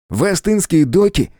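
high, stretched-out eager query; thrilled, high-pitched wonder; excited question. A narrator's reading. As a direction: ask as if bouncing with excitement. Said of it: "Том буквально подпрыгивал от возбуждения".

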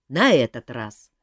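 ordinary delivery, nothing marked

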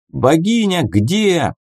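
high, drawn-out crowd call; demanding shout, stretched out; yelling crowd. A narrator's reading. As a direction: call out like an impatient mob. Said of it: "заорали синхронно сразу десятка триглоток".

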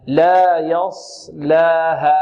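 very slow يصلاها, heavy ص, light ل; correct model. Shown as a demonstration. Tajweed teacher showing the word, slow careful يصلاها. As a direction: articulate each letter of يصلاها fully and slowly. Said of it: "ننطقها هكذا".

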